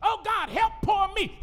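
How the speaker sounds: high shouted whiny parody; mocking whine. God parodying the preacher's prayer, mocking whine. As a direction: parody a self-pitying plea.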